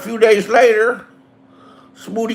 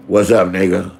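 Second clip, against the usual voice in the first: deep man's voice; 11 semitones lower than usual. Retold or imitated. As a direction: drop way lower into a man's voice. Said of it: "It sounded like it was a man".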